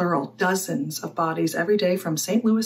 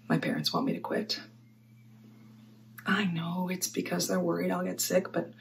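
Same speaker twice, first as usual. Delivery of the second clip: subdued confession; 9 dB quieter than usual. Acted. Mortician admitting parents want her to quit, subdued. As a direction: let the voice drop away, weary of the subject. Monologue: worried parents, overwork.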